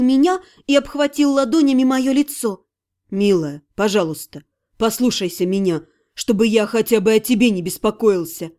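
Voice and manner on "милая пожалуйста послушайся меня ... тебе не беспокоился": gentle plea. Acted. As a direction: beg her to listen. Ирга pleads gently.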